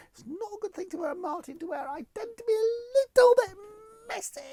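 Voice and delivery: very high, squeaky little voice; mock-sheepish confession. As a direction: squeak out an embarrassed admission.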